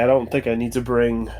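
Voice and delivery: ordinary delivery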